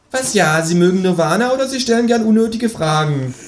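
high, bouncy know-it-all kangaroo; cheeky kangaroo voice. Acted. Kangaroo lecturing the narrator about precious time, high and cheeky. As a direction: play the smug kangaroo, pitched well up.

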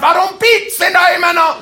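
loud, high frustrated yell; retold yell. Man yelling about the beeping car, loud. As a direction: bellow in frustration. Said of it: "hab brüllt ohne Ende".

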